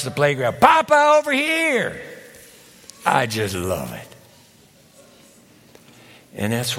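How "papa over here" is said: high, loud call across a room; child calling out. Retold or imitated. Granddaughter calling grandpa across the playground, high call. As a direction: call out to grandpa from far away. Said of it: "calls from across the living room".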